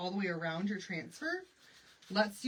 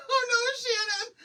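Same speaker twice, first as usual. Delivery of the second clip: high squealing laugh through words; 10 dB louder than usual. Real, not acted. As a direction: crack up while reading a name. Laughing at a viewer's comment.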